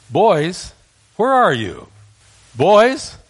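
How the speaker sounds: put-on calling out loud and high for the boys; hollering. Father calling his missing boys, loud. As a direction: holler for them across the yard.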